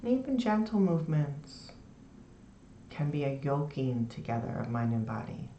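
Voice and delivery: soft, low, soothing meditation voice; guided meditation. Shown as a demonstration. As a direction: ease them into the body with long pauses. Leads a lying-down meditation.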